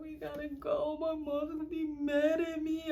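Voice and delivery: high, thin tearful whine; imitated crying. Drunk girl begging to leave, high whiny mock crying. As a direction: whimper it in mock tears. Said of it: "I'm just like crying".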